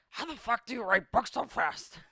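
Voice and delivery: high, loud burst of disbelief; incredulous George R.R. Martin. Retold or imitated. George leans over to ask Stephen King, incredulous. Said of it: "George leans over and he's like".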